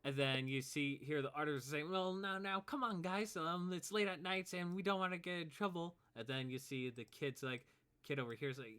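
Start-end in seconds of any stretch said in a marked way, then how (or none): 1.9-6.0 higher, conciliatory peacemaker voice; placating kid. Acted. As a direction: gently talk the bullies down, pitched up. Artist tries to calm the quarrel.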